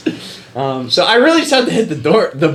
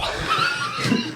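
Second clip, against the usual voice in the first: burst of laughter; 8 dB quieter than usual. Real, not acted. Reaction to final verse.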